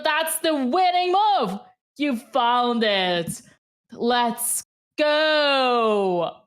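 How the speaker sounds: ecstatic high cheering at full voice; excited shout. Real, not acted. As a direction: erupt with joy for the winning move. Chess win, streamer erupts.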